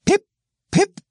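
high thin mock cheer; a heckler's thin mocking pip. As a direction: pipe it from the crowd. Thin voice from the ring.

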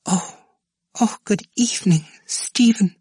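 high, frail simpering greeting; feeble whining woman. A narrator's reading. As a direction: greet him like a frail simpleton. Feeble Ellen voiced.

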